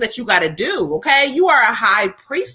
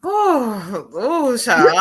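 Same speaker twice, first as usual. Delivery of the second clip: laughing to tears; much slower than usual. Real, not acted. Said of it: "I'm fucking in tears".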